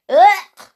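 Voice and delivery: high, loud "ugh" of disgust; sharp squeal of distaste. Acted. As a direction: recoil from a nasty taste with a short yuck noise. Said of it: "she pulled a face".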